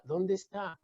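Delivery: very soft, deep low delivery; hushed awe. Acted. Preacher showing the church the calm after the storm, hushed. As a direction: sink to a reverent hush.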